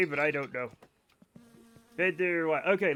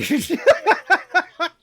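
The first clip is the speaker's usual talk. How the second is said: high, loud giggling fit; laughter; 10 semitones higher, 10 dB louder than usual. Real, not acted. Streamer agreeing British accent is worse, then cracking up.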